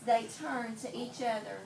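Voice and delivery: normal talking voice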